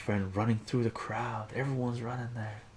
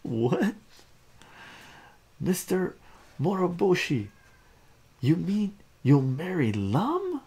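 shocked onlooker; 9 semitones higher, 6 dB louder than usual. Acted.

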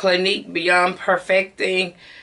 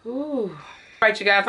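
ordinary talk, nothing marked

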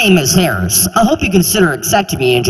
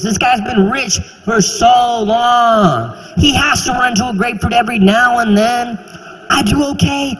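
incredulous rant; 6 semitones higher than usual. Real, not acted. Venting to the audience about [name], a higher incredulous rant. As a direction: rant at the absurdity. Ranting in disbelief.

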